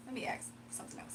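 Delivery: soft unvoiced aside; whispering. Real, not acted. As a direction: drop to a quick whisper. Whispered aside before the card pull.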